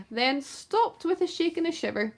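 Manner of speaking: ordinary delivery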